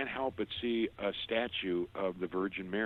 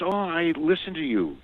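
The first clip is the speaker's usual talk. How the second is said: higher, louder, delighted clerk voice; excited fan; 9 semitones higher, 7 dB louder than usual. Retold or imitated. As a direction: gush like a thrilled listener meeting the host. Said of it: "And she goes".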